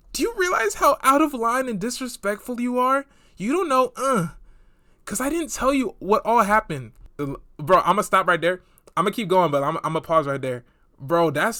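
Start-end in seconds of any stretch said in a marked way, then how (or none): 0.1-6.9 put-on higher, indignant voice of the girl; higher mock-outraged texting voice. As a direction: read it as the outraged texter. Reading the girl's angry text.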